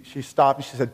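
ordinary talk, nothing marked

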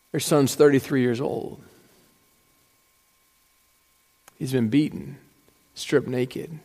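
soft, low, grave telling; hushed lament at the cross; solemn hush. Real, not acted. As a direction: drop low and let each phrase land. Describing crucifixion solemnly.

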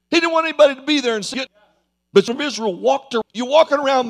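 loud, high, rapid pulpit preaching; preaching at full force. Acted. Preacher scolding Naaman before the church: fervent preaching. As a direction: fire it at the congregation.

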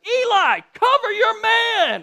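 high, loud yell, slowed on cover your man; a mom yelling down to her son on the court; parent yelling. Retold or imitated. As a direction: bellow from the stands at your son. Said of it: "I finally just went".

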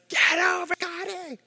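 very high, strained mock scream; high-pitched scream held down in volume; mom screaming. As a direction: imitate a mother screaming from afar. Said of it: "she was screaming at me".

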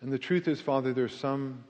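earnest pastoral prayer, rising in force. Real, not acted. Pastor praying for the bowed congregation, earnest. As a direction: pray over the congregation with conviction.

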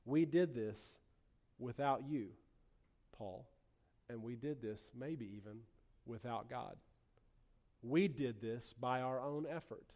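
low and very soft, sinking almost to nothing; hushed inner thought. Acted. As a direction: confide it quietly, like a thought barely admitted. Voices church's secret proud thought.

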